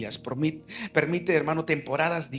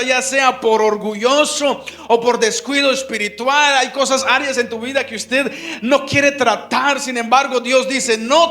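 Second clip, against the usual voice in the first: loud, high, fervent preaching to the congregation; preaching at full force; 8 semitones higher, 11 dB louder than usual. Acted. Pastor urging the brothers on marriage, loud fervent preaching. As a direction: proclaim it like a pulpit call, louder and higher.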